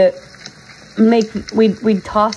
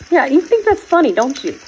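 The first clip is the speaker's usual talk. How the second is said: higher playful mock-scolding; teasing; 6 semitones higher than usual. Real, not acted. Teasing her pet again, higher playful scolding. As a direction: needle him, pitch up on "that's".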